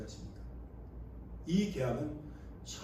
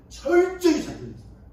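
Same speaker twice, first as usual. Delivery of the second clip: much higher and louder, hammering 철저히; fiery sermon outburst; emphatic preaching shout; 11 semitones higher, 9 dB louder than usual. Real, not acted. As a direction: drive the point home at full force.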